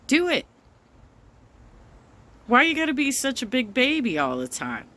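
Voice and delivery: normal talking voice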